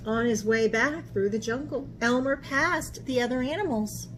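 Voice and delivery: usual speaking voice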